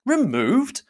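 high, startled question; high-pitched, scandalized echo; astonished cry. A narrator's reading. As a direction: exclaim it in surprise. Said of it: "cried Bingley".

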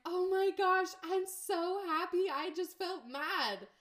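high, loud, delighted cry; excited squeal. Retold or imitated. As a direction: gush with joy over a small win. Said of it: "I'm like".

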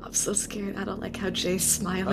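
hushed, whispered aside; real nervous whisper. Real, not acted. Player uneasy at a friend's smile, nervous whisper. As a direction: whisper it under your breath.

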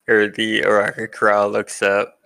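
ordinary talk, nothing marked

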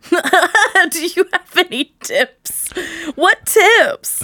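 high, slow, drawn-out incredulous echo; mock disbelief. Friend mocking the coworker's request for tips, incredulous. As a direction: repeat it high and aghast, hammed up.

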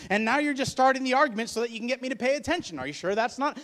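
acted marital argument. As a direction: argue back and forth, pleading your case loudly. Now you're just starting the argument.

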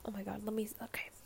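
normal talking voice

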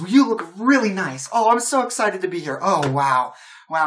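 thrilled, wide-eyed wonder; excited greeting. Acted. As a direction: bubble over with delight at new company. Lydia excitedly greets the crew.

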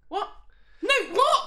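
high, loud disbelief; exasperated, high-pitched retold reaction; incredulous outburst. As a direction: burst out baffled at the absurdity. Retold baffled reaction to film scene.

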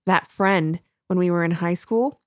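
ordinary delivery

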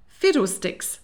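loud, high, dismissive exclamation; snapping. A narrator's reading. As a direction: snap it out in brusque dismissal. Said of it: "snapped Missus Delamere".

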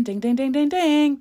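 klaxon imitation. Shown as a demonstration.

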